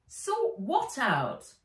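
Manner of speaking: slow, high puzzled question; drawn-out girlish query; young girl voice. Acted. Nat asks slowly.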